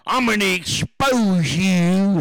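loud, stretched-out cartoonish threat; hammed-up angry warning; mock threat from critics. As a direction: mimic an outraged critic, dragging out the words. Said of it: "That's what they tell me".

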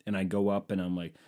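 normal talking voice

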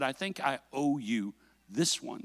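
soft, low and sincere; quiet forgiving father. Retold or imitated. Dad telling his son he owes him, quiet and sincere.

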